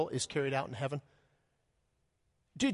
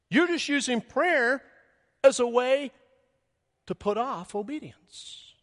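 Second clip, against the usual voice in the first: preaching at full force; 7 semitones higher, 5 dB louder than usual. Real, not acted. Preaching emphasis.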